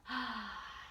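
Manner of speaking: quiet surprised gasp; soft gasp. Acted. Teacher gasping at the finished soup. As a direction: gasp softly as if delighted.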